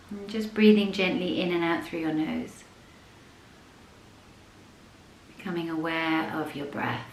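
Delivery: soft, unhurried yoga nidra voice with long pauses; guided meditation. Acted. Leads a yoga nidra practice.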